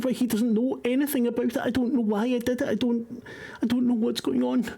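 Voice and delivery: high, frantic confession in a stammer; breathless panicked young man; panicked informant. Acted. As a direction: blurt it out terrified and confused. GM voices panicked NPC Paul.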